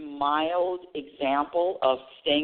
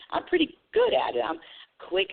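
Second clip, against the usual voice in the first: normal talking voice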